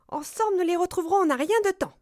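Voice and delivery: high, fast mouse voice; squeaky mouse. A narrator's reading. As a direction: squeak eager encouragement, quickly. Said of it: "couina la souris". (couinement de souris, aigu et rapide)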